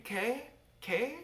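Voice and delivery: high, questioning little repeats; puzzled man repeating. Retold or imitated. Swaying man not understanding the women, high puzzled repeating. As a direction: pitch each word up like a question. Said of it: "swaying gently and repeating".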